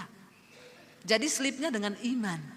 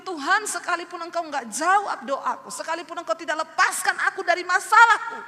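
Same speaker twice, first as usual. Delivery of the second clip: fervent prayer; 7 semitones higher, 8 dB louder than usual. Real, not acted. Fervent prayer after hymn.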